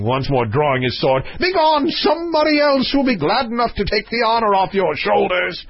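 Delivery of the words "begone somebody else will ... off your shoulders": high, furious royal dismissal; roaring king. Acted. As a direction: send him packing as an enraged king. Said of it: "roared the king".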